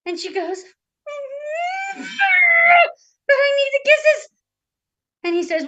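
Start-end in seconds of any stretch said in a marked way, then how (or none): 0.6-4.3 very high, drawn-out pretend whine; mock wailing princess. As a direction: wail it like a hammed-up addict. Princess wails for her kisses.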